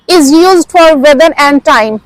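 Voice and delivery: normal talking voice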